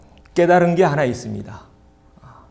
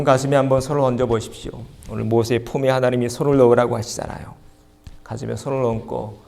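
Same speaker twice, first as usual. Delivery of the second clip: usual speaking voice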